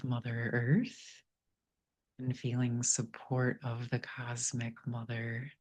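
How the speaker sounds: soft, unhurried meditation voice with long pauses; guided meditation. Real, not acted. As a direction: gently guide listeners inward, leaving space between phrases.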